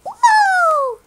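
high, drawn-out whoop; excited woo. Real, not acted. Showing off how cool I look, high whoop. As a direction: let out a high cheer. Cheering own cool penguin.

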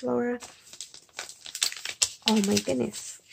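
normal talking voice

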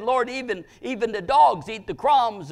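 high, loud plea to the Lord; preacher voicing an insistent beggar; retold woman's cry. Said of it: "this Canaanite woman said".

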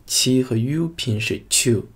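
usual speaking voice

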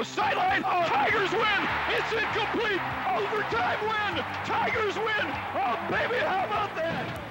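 very high, ecstatic winning call; touchdown call. Real, not acted. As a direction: scream the overtime win. Overtime winning play called live.

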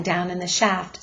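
ordinary delivery, nothing marked

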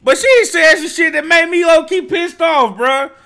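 loud, high, fired-up rant; pissed-off outburst. Real, not acted. Venting at the track, loud and pissed off. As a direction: get heated about what she said. Reacting angrily to a song lyric.

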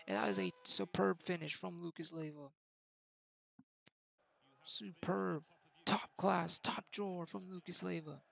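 commentator calling the match; live play-by-play. Acted. Gamer calling his own match like a TV commentator, live play-by-play. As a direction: call it like a TV football commentator.

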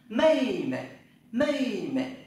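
mây mẹ said high and loud, falling; Chinese tone drill. Shown as a demonstration. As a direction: belt out mây mẹ as a model. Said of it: "E với I thì đọc là A rồi".

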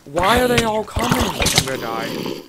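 loud panicked gamer wail; panicked yell. Real, not acted. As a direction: yell in real panic, then sink into despair. Swarmed by mobs in game.

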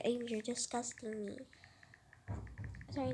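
normal talking voice

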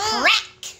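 cheerful spoken crack; crack sound effect. Acted. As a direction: call out the breaking crack. Story sound effect, carriage breaks.

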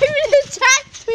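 high, loud excited telling. Real, not acted. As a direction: blurt it out excitedly. Excited after bouncing.

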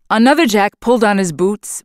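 ordinary delivery, nothing marked

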